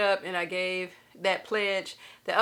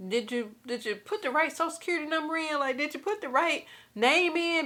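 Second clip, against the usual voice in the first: disbelieving; 6 semitones higher than usual. Retold or imitated. Disbelief at being approved.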